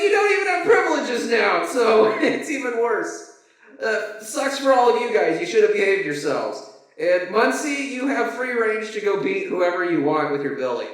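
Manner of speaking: high, giggly mock warden; gloating warden. Warden gloating to punished prisoners, high mock glee. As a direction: taunt them with a laughing sneer. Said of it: "And he's like".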